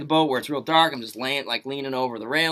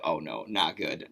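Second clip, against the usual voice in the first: lower, softer, slow dismayed mutter; 12 semitones lower, 8 dB quieter, slower than usual. Retold or imitated. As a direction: sink as you realize it's going wrong. Realizing the bad sign.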